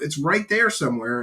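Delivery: ordinary delivery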